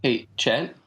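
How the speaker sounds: normal talking voice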